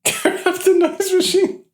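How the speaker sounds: mocking echo. Mocking repeat of friend.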